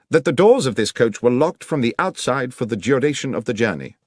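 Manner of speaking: lightly voiced keen detective; a hint of a brisk Holmes pressing facts; Holmes, crisp questioning. Holmes questions the inspector in the coach, brisk and keen.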